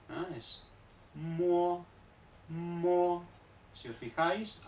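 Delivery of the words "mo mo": mo with a faint u glide into the o; Chinese syllable mo. Shown as a demonstration. Teacher models mo for the class. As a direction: lips small first, then open to o in mo. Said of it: "La M, lo mismo".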